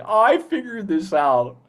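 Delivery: high, slow, smug cartoon voice; cheeky Wayne. Actor voices cheeky Wayne figuring something out, high and smug. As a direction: sound pleased with yourself. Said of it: "I love cheeky Wayne".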